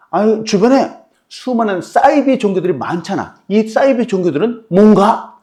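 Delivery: high mocking question; sarcastic challenge. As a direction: push him into a corner. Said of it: "딱 이렇게 물어본 거예요".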